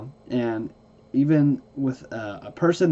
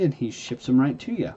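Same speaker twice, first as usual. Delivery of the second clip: ordinary talk, nothing marked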